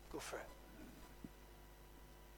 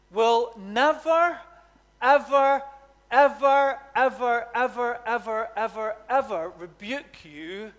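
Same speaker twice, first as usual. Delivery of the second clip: each "ever" stretched and pressed, louder at first, then fading; emphatic repetition; 2 semitones higher, 5 dB louder, slower than usual. Real, not acted. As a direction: hammer every "ever", then let it fade. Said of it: "repeats ever for emphasis".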